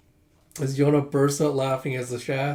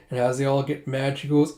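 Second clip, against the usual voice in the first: ordinary delivery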